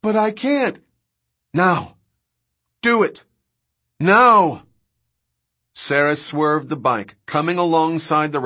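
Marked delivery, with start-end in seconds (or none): 0.0-4.6 high, pressing exchange; raised voice, insistent and high; urgent shouting. A narrator's reading. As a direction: call out urgently over engine noise. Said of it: "Sarah shouted".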